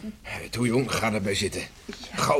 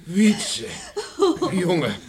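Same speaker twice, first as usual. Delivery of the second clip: joyful cry; 12 semitones higher, 4 dB louder, much slower than usual. Acted. Mother greets returning son joyfully.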